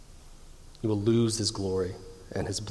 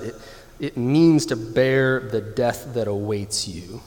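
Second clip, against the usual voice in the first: usual speaking voice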